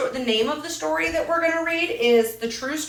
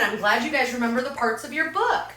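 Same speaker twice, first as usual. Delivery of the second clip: normal talking voice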